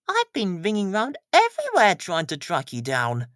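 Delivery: lightly voiced worried postmistress; Mrs Goggins voice.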